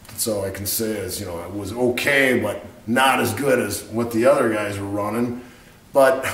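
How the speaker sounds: usual speaking voice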